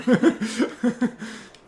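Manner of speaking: loud, high burst of laughter; real high-pitched laugh. Real, not acted. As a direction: crack up at your own teasing. Teasing girlfriend about her glare.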